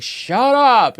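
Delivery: high mock yell from the audience; imitated heckler. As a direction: imitate an annoyed moviegoer calling out. Audience member snaps at mom.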